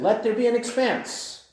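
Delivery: a preacher voicing God's command. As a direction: announce it like a royal decree.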